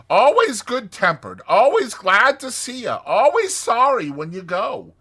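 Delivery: loud, high, hearty voice; rally them with gusto; hearty enthusiasm. Acted. Rat urges Mole to visit Toad, hearty.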